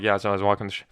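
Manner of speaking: rushed and slurred, tripping on "the sh-"; fast, mumbled. Shown as a demonstration. Host shows careless fast talk, rushed and broken off. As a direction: hurry it until the words run together. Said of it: "if you're talking real fast and you're like".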